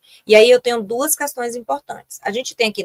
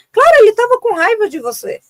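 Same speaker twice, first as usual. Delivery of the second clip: much higher and louder, emphatic; emphatic retort; 11 semitones higher, 6 dB louder than usual. Real, not acted. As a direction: burst out with the obvious answer. Emphatic answer to parents. (resposta enfática aos pais, bem mais alta e forte)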